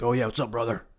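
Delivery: lightly voiced deep-voiced guy; a hint of a deep, easygoing voice. Big guy greeting you as brother, lightly deep voice. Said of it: "got a deep voice".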